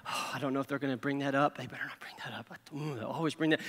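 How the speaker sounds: low, half-whispered worrying; fretful muttering. Acted. Worrier on the way to work, whispering fretfully to themselves. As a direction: mutter anxiously to yourself, barely voiced.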